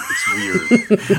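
shouted through a laugh; cracking up loudly. Real, not acted.

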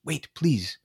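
pleading. Acted. Raven begs the dragon.

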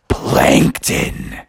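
very low, rough drawn-out growl; accusing group. Acted. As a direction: growl the villain's name down low. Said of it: "they were all like".

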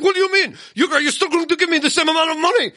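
high-pitched imitation of an irate driver; mad Uber driver. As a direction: play the indignant driver, high and worked up. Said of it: "he got mad at me".

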